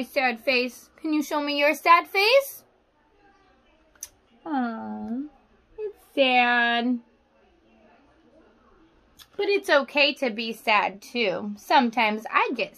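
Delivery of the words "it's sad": ordinary delivery, nothing marked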